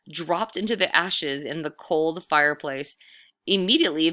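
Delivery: ordinary delivery, nothing marked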